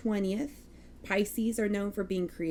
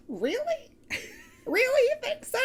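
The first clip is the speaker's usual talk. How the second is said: squeaky high mock disbelief that cracks; voice cracking upward. Host joking about being called very Texan, voice cracking high. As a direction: shoot way up high, voice breaking on it. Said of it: "Stupid voice crack".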